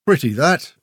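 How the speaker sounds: lightly voiced, appraising little man; little man's remark. A narrator's reading. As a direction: remark on it as if sizing it up.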